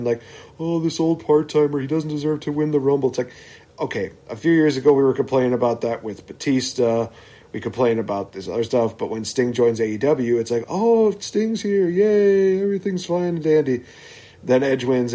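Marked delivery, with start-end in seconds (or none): 0.6-3.1 imitated fan grumble, slightly higher; mock complaining. Host mimicking fans who resent Edge's win, mock complaint. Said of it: "there are people that complain". 10.7-13.8 high, drawn-out fake delight; mock cheering. Host mimicking fans welcoming Sting to AEW, mock delight. Mocking fans' double standard over Sting.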